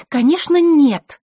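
sharp, high, loud retort. Acted. Scene: Zakhara cutting off her guest, sharp and loud. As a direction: snap it back hard. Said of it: "резко произнесла Захарра".

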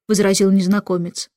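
ordinary delivery

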